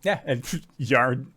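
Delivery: ordinary talk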